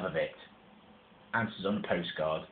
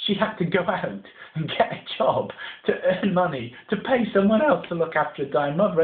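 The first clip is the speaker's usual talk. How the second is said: high, loud, laughing through the words; can't help laughing at the absurdity; giggling despite the sad story; laughing while telling; 9 semitones higher, 5 dB louder than usual. Real, not acted. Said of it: "I don't know why I'm laughing".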